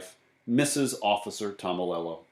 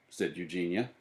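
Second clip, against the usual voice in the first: ordinary delivery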